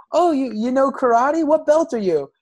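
high, loud imitation of an impressed kid; hammed-up boyish curiosity; eager pupil. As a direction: pitch it up like an excited student. Voices eager pupil Daniel.

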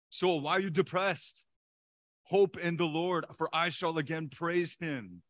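loud, high, slow exhortation; preaching to himself. Acted. Preacher voices David addressing his soul, forceful slow exhortation. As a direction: drive each phrase home to a downcast soul. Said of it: "He has to preach to his soul".

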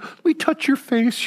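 put-on old woman asks to touch the visitor's face, tender and high; sweet old lady. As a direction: gently coax like a loving grandma. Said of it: "She would".

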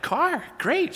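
put-on little girl thrilled by a cop car; excited little daughter. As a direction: squeal it like a kid seeing a police car.

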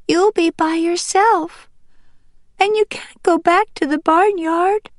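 put-on high, plaintive child-character voice; young duck Green Top, upset. Young duck fears his mother will be alone, upset. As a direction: protest in a high, worried young voice.

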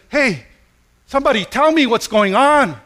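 high, loud call for attention. Acted. Blind beggar calling to passersby, loud and urgent. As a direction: call out over a crowd.

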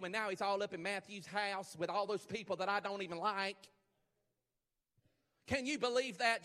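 high, loud, scandalized Pharisee; hammed-up indignant tattling in a put-on accent; gossiping Pharisee. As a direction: act out an outraged onlooker telling tales. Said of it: "what kind of accent that is".

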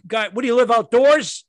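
loud, fast scolding parent; crisp disapproving governess. Retold or imitated. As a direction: bark at them like a strict mom. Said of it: "that's a New England".

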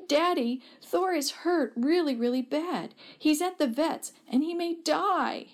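high-pitched, acted child's distress; crying out. A narrator's reading. Daughter telling Dad the cat is hurt, acted high tearful cry. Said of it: "threw herself into his arms and cried".

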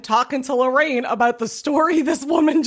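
ordinary talk, nothing marked

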